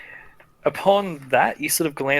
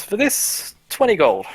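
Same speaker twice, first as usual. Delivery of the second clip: merchant voice; 7 semitones higher, 3 dB louder than usual. Acted. Merchant names price.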